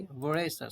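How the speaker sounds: voracious drawn out slowly, vo-RAY-shus, falling at the end; voracious said slow and deliberate; slow teaching demo. Shown as a demonstration. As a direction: stretch out voracious syllable by syllable for the class. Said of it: "देखिए".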